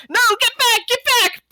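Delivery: high, frantic get back; panicked shout. Acted. Elf shouts at the rats swarming his kitchen in panic.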